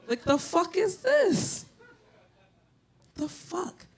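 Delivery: high, slow, stunned squeak; baffled, almost squealing; high drawn-out what-the-hell; shocked disbelief. Retold or imitated. Reacting to the C-section.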